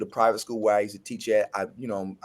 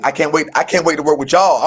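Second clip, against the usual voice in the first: retold eagerness; 4 semitones higher, 11 dB louder than usual. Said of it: "I'm like".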